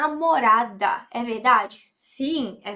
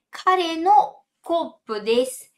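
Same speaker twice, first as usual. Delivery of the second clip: slow teacher model; 4 semitones higher, 3 dB louder, slower than usual. Shown as a demonstration. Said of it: "teacher's slow model sentence".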